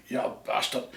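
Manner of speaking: low gruff Glaswegian mutter; grunting Glasgow accent. Retold or imitated. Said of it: "Glasgow it's mostly grunts".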